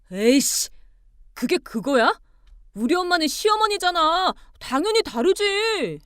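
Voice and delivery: loud, rising to high insistence; angry outburst. Acted. Husband defending his mother's status, angrily insistent. Husband loses temper in quarrel.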